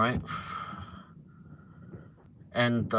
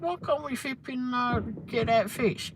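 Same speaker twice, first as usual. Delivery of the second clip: mock indignant complaint; 12 semitones higher, 3 dB quieter than usual. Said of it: "I said".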